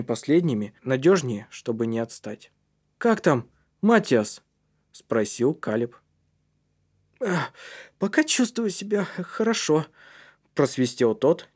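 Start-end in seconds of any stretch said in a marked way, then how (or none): 3.0-4.4 lightly voiced boy, higher pitch; calling to a friend. A narrator's reading. Калиб asks Матиас how he is doing, concerned. 7.2-9.9 put-on high, winded boy's voice; breathless but glad. A narrator's reading. Матиас answers his friend from the chimney, panting happily. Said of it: "тяжело дыша, но с радостью в голосе".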